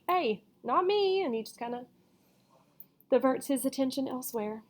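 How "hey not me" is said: high, drawn-out not me; innocent denial. A narrator's reading. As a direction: play innocent. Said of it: "So he's like".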